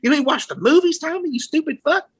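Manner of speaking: high-pitched exasperated scolding, climbing to the end; mock angry rant, pitch shooting up; imitated yelling granddad. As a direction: imitate granddad chewing out his son. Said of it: "I remember my fucking granddad yelling".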